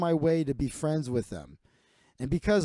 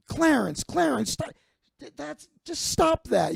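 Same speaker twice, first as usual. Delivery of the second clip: high, exasperated "Clarence, stop"; a sister begging her brother to quit; mom pleading; 11 semitones higher than usual. Retold or imitated. Mom trying to stop Clarence in front of her son, high exasperated plea.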